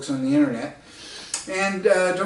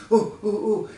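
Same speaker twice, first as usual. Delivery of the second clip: higher mock ooh of an eager old woman; excited old lady; 6 semitones higher than usual. Elderly lady excited about the road, mock eager oohs. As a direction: gush like a thrilled elderly lady. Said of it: "So now she's really excited".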